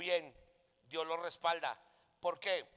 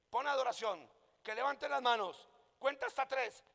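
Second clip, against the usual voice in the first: higher-pitched listed commands; quoting a series of directions in a raised pitch; retold instructions; 7 semitones higher than usual. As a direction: relay orders one by one, voice lifted. Voices God's instructions to him.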